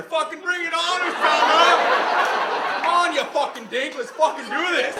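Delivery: high, shouted Northern Ontario taunt; Northern Ontario accent. Acted. Canadian soldier challenges the enemy, loud Northern Ontario accent. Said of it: "That's my Northern Ontario accent".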